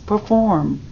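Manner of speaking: usual speaking voice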